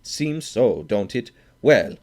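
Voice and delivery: loud and high, aggrieved and sneering; indignant outburst. A narrator's reading. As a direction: needle the room, loud and sore. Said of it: "he exploded".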